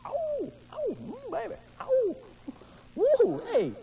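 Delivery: high, breathy excited oohs; excited ogling. Acted. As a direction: ogle like a smitten fool. Ogling woman, excited noises.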